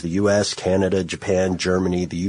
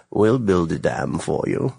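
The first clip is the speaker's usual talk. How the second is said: barely acted villain line; a hint of a sinister offer; lightly voiced villain. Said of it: "you could probably do a better villain voice".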